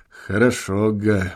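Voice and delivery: low, soft mutter; under his breath. A narrator's reading. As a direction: echo it quietly to yourself. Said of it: "повторил вполголоса".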